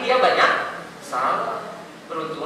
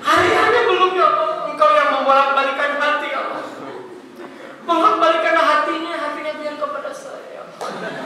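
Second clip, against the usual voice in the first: mock crying prayer; 6 semitones higher than usual. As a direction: imitate her sobbing plea with a laugh in it. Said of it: "tiba-tiba dia nangis kan".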